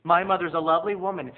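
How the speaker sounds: normal talking voice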